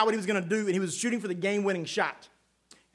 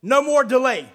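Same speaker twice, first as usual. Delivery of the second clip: louder, higher declaration of no more delay; emphatic proclamation; 5 semitones higher, 7 dB louder than usual. Acted. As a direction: proclaim it to the congregation. Preacher proclaiming opening line.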